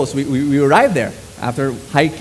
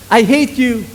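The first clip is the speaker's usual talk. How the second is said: mock hateful shout; 7 semitones higher, 5 dB louder than usual.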